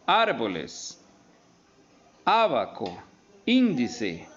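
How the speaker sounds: first syllable punched in "árboles", "abaco", "índice"; stress on antepenultimate syllable. Shown as a demonstration. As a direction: hit "ár", "a" and "ín" hard at the start of "árboles", "abaco", "índice", then fall away. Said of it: "tienen el acento en la antepenúltima sílaba". ("árboles", "abaco", "índice" con la primera sílaba marcada)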